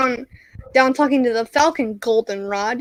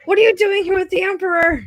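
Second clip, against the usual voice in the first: high astonished mock question; Vader surprised; 8 semitones higher than usual. Vader finds his dad with the Emperor, high mock surprise. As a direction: sound caught off guard. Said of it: "Vader shows up and is like".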